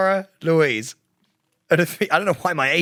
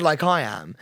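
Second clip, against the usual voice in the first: ordinary delivery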